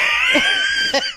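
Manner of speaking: loud, very high burst of laughter; hard laughing. Real, not acted. Host losing it at her cohost, hard laughing. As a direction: crack up completely. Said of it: "you made me laugh really hard".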